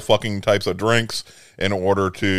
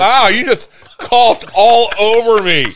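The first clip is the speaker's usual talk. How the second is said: laughing; 9 semitones higher, 3 dB louder, slower than usual. Real, not acted. Got spat on, laughing.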